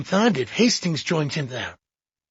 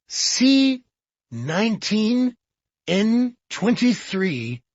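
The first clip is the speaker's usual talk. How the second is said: reading aloud; 6 semitones higher, much slower than usual. A narrator's reading. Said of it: "began to read aloud".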